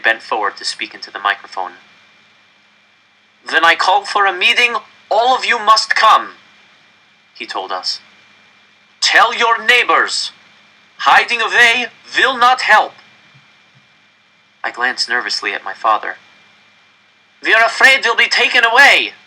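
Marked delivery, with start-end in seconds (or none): 3.5-6.4 director's raised, higher public address; stern official. Acted. Director warning the crowd into the microphone, raised and stern. 9.0-12.9 director's raised, higher public address; stern official. Acted. Director warning the crowd into the microphone, raised and stern. 17.4-19.1 high, loud cry from the crowd; fearful yell. Acted. Frightened crowd member yelling at the director. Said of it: "someone yelled".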